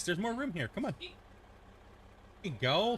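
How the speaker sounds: high coaxing call to the cat; pet talk. Real, not acted.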